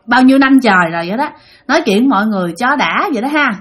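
ordinary delivery

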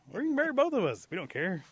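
loud, very high comic pitch; over-eager offer. Acted. Rabbit proposing to the girl for both, loud and over-eager.

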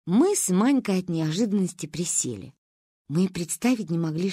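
ordinary delivery

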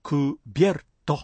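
クビエルト broken into three beats, ku-bier-to. Shown as a demonstration. Teacher models the word for covered, クビエルト in three beats. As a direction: keep "bier" as one syllable in クビエルト. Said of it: "三拍です".

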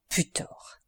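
a muttered curse, breathed out unvoiced; whispered insult. A narrator's reading. Danglars insults the eating bandit, whispered curse. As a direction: hiss it at his jailer's back.

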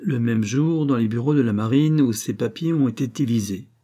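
usual speaking voice

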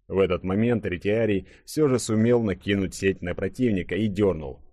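usual speaking voice

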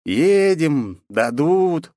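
high, drawn-out teasing echo; mocking mimicry. A narrator's reading. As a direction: parrot his words back in mockery. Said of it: "передразнил я его".

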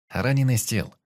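ordinary delivery, nothing marked

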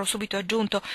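plain reading voice

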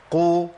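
"قو" with rounded lips after a heavy qaf; qaf with damma. Shown as a demonstration. Teacher showing learners the damma qaf, clearly. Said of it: "القاف المضمومة".

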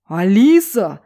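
loud bellow of a name; roaring father. A narrator's reading. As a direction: roar it like a bear. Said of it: "не хуже медведя заревел отец". (отец ревёт, как медведь)

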